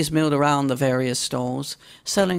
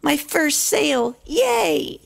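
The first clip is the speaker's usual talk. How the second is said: high, delighted filly cheer; high-voiced young filly cheering her first sale; excited cry; 13 semitones higher, slower than usual. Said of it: "she cried".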